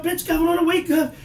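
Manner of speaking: high, loud rousing call; mock urgent wake-up. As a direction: shake her awake with your voice. Waking wife up.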